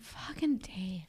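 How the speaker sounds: slow, soft whispered curse; exasperated whisper. Retold or imitated. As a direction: breathe the name in disgust. Muttered annoyance at Dave.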